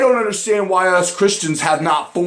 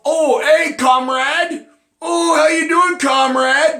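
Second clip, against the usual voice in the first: high, sing-song mock friendliness; mock them with a fake-sweet hello; mocking greeting; 8 semitones higher, slower than usual. Mocking leftists greeting each other.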